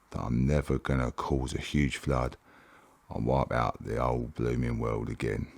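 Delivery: put-on Bible story read aloud in a Cockney accent. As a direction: tell it like a cheeky Cockney storyteller, Cockney accent throughout. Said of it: "that's a little bit of the Cockney Bible".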